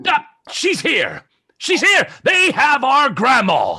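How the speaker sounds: high, loud cry of discovery; alarmed cry. Acted. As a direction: raise the alarm to your friends. Player cries out on discovering grandma.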